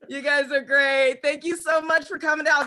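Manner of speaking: usual speaking voice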